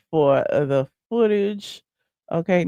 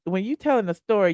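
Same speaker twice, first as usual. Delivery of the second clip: ordinary talk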